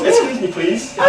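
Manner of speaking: high, polite Surrey request; best Surrey accent. Retold or imitated. Newcomer asking strangers on the street, Surrey accent. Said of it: "in my best Surrey accent".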